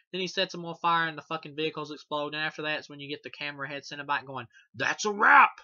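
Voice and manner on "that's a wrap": loud, high hammed-up shout; big mock announcement, much louder; villain quip. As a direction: bellow it like a cheesy movie villain. Camera head Cenobite quoted.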